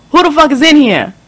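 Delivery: high, loud scared yell; tied up, shouting out for whoever's there. Acted. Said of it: "I yelled as loud as I could".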